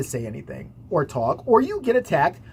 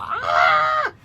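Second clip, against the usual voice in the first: piercingly high mock shriek; hammed-up wail like a hurt animal; wounded-antelope scream; 4 dB louder than usual. Imitating teacher on the floor, wounded-animal scream. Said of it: "sounds like a fucking wounded antelope".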